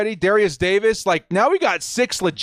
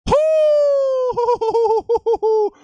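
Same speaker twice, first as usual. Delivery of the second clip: high whoop of pure joy; excited whoop. Real, not acted. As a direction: let out a wild cheer. Celebrating a new draft pick.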